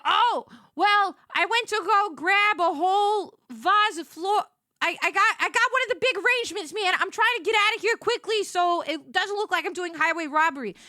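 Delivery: high, loud, flustered rush; giddy panic. Retold or imitated. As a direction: confess it breathless and giggly. Panicky confession about stolen arrangement.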